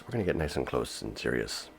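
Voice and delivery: low, soft, mock-serious radio intimacy; close and personal. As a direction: drop the voice and get confidential. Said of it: "get ourselves a little bit serious".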